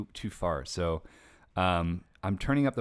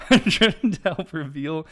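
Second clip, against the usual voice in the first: real tears breaking through, slower; choked up, laughing; 8 semitones higher, 10 dB louder than usual. Real, not acted. Rereading his intro while fighting tears. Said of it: "I'm gonna cry".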